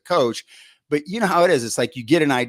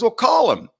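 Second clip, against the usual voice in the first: imitating a much higher, louder nudge; mock insistent push to phone; urging call him; 12 semitones higher, 6 dB louder than usual. Coordinator urging the young coach to call, much higher and louder.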